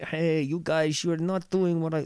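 a hint of Dutch in the voice; Dutch accent. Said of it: "that's a Dutch accent".

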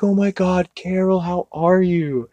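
high imitation of a gushing host; lightly hammed-up happy surprise; mock delighted greeting. Retold maitre d' greeting Carol.

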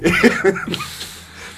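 tail of a high laughing fit; real high-pitched laugh; high laughter. Real, not acted. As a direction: keep giggling helplessly, high up. Cracking up over a name.

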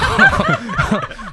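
loud, high burst of real laughter; big laugh. Real, not acted. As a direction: crack up hard. Laughs at penis joke.

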